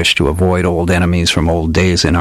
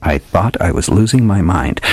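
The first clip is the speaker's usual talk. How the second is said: ordinary delivery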